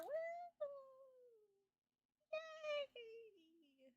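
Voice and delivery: squeaky high sarcastic woo and yay; fake-cheer like a sarcastic fan; high, soft, ironic celebration; mock cheer. Sarcastic cheer at villain twist.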